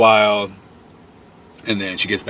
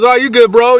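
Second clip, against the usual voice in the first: high, loud, easing off the threat; imitated gunman; 10 semitones higher, 5 dB louder than usual. Gunman to the girl's boyfriend, high loud imitation. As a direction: play the neighbour loudly letting him off.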